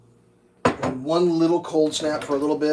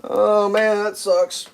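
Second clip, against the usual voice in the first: higher, louder sarcastic echo; mock sympathy repeated; 8 semitones higher, 7 dB louder, much slower than usual. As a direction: echo his line sarcastically.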